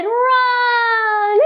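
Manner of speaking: high, squeaky little mouse; tiny mouse cry. Acted. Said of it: "The mouse said".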